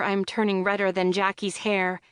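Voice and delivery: plain reading voice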